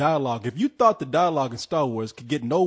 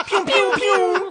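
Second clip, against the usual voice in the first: blaster noises; 4 dB louder, much slower than usual. Pretend. Mimicking blaster sounds.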